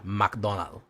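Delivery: "McDonald" ending on the L, no S; dropped final S. Shown as a demonstration. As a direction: leave the S off the end of "McDonald". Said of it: "you're gonna drop S's".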